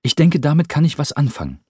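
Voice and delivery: viel höher, lauter, fröhlich drängend; junger Cameron, munter. Acted. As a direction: heller junger Mann, der Rupert aufmuntert. Cameron voiced by narrator. (hohe, lebhafte Jungenstimme)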